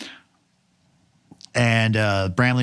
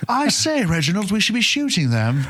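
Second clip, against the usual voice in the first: posh British officer; 10 semitones higher than usual. Acted.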